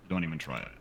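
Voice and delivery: ordinary talk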